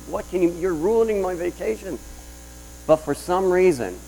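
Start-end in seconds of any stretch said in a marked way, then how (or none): 0.1-2.0 retold angry outburst. Pastor retelling his first instinct to the congregation, mock angry. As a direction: act out being upset, pitched up. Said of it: "I was mad".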